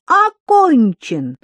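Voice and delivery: high, loud, slow announcing; loud announcement. Acted. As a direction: announce it to the hall. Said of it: "объявила Тося".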